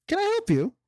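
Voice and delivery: tiny high little voice; pixie. A narrator's reading. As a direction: squeak it like a tiny sprite. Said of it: "you hear a little voice".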